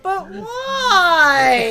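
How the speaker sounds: high whining pet voice; whiny cat voice. Acted. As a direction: whine it like a put-out cat. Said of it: "a cat that was more like".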